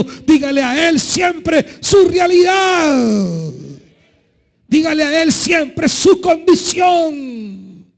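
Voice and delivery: fervent sermon climax; preacher's shout. Acted.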